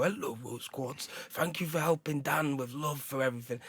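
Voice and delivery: lightly put-on Wolverhampton voice thanking a crystal; mild imitation of a Wolverhampton mate; Wolverhampton accent. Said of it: "a thick Wolverhampton accent".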